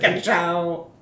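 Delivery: punchy race car catchphrase; Lightning McQueen catchphrase. Retold or imitated. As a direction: bark it like a cocky race car. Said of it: "he stole that from me".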